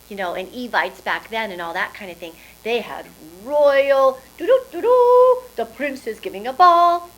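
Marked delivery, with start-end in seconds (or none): 4.4-5.4 high, loud mouth fanfare; trumpet fanfare. Pretend. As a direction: toot like a royal herald's trumpet. Royal herald trumpet fanfare.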